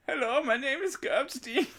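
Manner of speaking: very high-pitched put-on character voice; Kurt impression. Introducing himself as Kurt in a mocking impression, very high-pitched. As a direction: do a silly, squeaky caricature. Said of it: "That's my impression of him".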